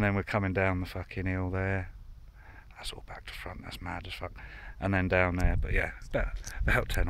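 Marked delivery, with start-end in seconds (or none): 2.8-4.3 under-breath whisper to himself. Real, not acted. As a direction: whisper it aside to yourself. Muttering to himself.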